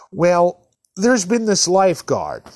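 ordinary delivery

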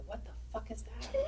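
whispered curse, barely audible; under her breath. Retold or imitated. Mom retelling daughter kicking birdseed, whispered under breath. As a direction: mutter it like a kid to herself. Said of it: "under her breath go".

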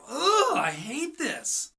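high groaning "Ugh", then a slow sullen line; frustrated groan. Retold or imitated. After the failed call with the client, a frustrated groan then slow words. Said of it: "it made me mad".

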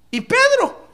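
Pedro called out high and loud; sudden exclamation. Real, not acted. Preacher to his congregation, a sudden loud high call.

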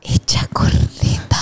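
hushed, unvoiced; whisper. Real, not acted. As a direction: whisper it, wrecked. Susurra su estado. (susurrado, deshecha)

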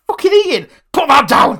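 loud, high alarmed command; retold dog-scolding shout. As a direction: re-enact yelling at a dog. Re-enacts shouting at parents' dog.

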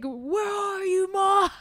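mock wailing shout, high and drawn out; retold yelling. Recapping Matthew calling for his mother, imitated yell. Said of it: "Matthew just starts yelling".

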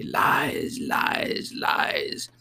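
harsh unvoiced goblin whisper; eerie, coarse whispered jeer; hoarse indistinct voice. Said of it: "and a hoarse, indistinct voice".

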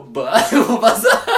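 devagar entre risadas; rindo. Retold or imitated. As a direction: sai de fininho rindo. Menino foge de fininho. (rindo e arrastando)

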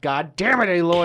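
mock exasperation. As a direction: scold a game character in mock outrage. Retelling scolding the game character.

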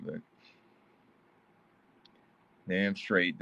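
ordinary talk, nothing marked

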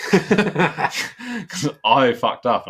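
high, loud, drawn-out laughing admission. Real, not acted. Buyer owning up to losing the bike, laughing. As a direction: laugh helplessly through the words, slow and loud. Laughing at own costly mistake.

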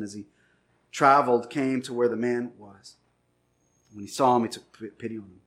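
low, soft, measured Bible reading; scripture reading. Acted. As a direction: read the verse quietly and reverently. Reads scripture aloud.

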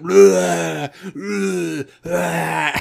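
a touch of a dumb brute's blah; high, loud mocking blah blah; mock grunt talk. Mocking a wordless meathead hero, loud high blahs. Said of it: "this guy who said nothing but".

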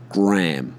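"Graham" said as a single "Gram"; middle missed out. Shown as a demonstration. Spelling out the American version, one-syllable name. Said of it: "completely miss the middle of the word".

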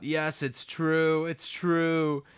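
high, drawn-out confession; mock outburst. Guilty suspect bursts out confessing, mock outburst. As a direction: burst out with it, stretching the words. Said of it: "but then he bursts out".

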